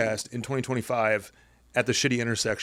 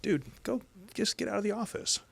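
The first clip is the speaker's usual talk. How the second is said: inner voice urging; 11 semitones higher than usual. Retold or imitated. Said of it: "It's also for myself of going".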